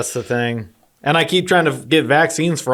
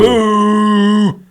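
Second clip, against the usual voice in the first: loud high mooing cow; 9 semitones higher, 7 dB louder than usual. Pretend. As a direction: belt out a cow's moo. Punchline of interrupting cow joke.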